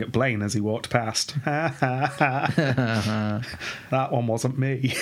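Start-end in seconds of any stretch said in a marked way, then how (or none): 1.4-3.8 slow mock gloating laugh; smug put-on laugh. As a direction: laugh smugly at a beaten rival. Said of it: "in a slightly smug way".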